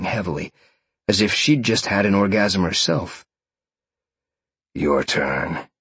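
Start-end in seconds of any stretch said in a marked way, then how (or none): 4.7-5.7 low purring male character voice; purred. A narrator's reading. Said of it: "Sean purred".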